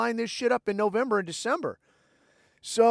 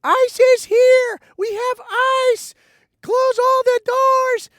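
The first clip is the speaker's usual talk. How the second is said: shrill panicked shriek, much higher and louder, slow; screaming alarm; 9 dB louder, slower than usual. Retold or imitated. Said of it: "she starts screaming".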